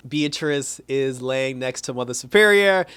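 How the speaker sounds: usual speaking voice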